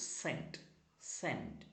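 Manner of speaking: normal talking voice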